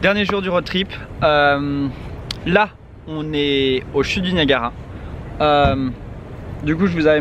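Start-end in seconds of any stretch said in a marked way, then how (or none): none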